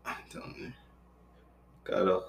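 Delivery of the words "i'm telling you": whisper. Real, not acted. Aside while ignoring phone call.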